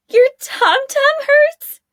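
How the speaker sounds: very high, slow giggly repeat; incredulous teasing. A narrator's reading. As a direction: tease him through nervous giggles. Girl mocks giggling.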